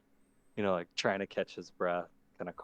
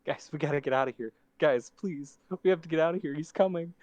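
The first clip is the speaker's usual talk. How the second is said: higher, louder panicked pleading; scared kid voice; 8 semitones higher, 10 dB louder than usual. Acted. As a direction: beg them to flee, terrified. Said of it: "how did I do the voice?".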